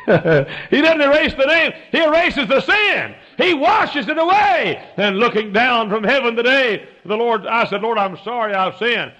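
high, shouted preaching, drawn out at first; full-force preaching. Real, not acted. Preacher confesses his mistakes to the congregation, full-force preaching. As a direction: preach it at the top of your voice.

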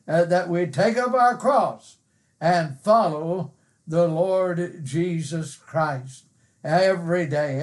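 ordinary talk, nothing marked

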